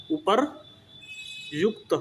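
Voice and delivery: उपरयुक्त drawn out syllable by syllable, very slowly; slow spelled-out word. Shown as a demonstration. Teacher spells the word for the children, very slow and careful. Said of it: "इस प्रकार से लिखा जाता है".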